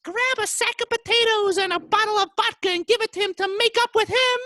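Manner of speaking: loud, very high falsetto yell; hammed-up shrieked orders; yelling wife. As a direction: yell after the husband in a piercing wife voice. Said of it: "And she yells".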